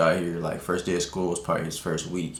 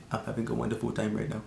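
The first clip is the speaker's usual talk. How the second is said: softly imitated Indian accent; 6 dB quieter than usual. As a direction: do a stoned friend's Indian accent, quietly. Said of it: "I ain't even wanna use the accent".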